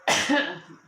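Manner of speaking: real choking cough; coughing up water. Real, not acted. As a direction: cough from the wrong pipe. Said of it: "it went down the wrong pipe".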